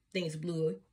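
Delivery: usual speaking voice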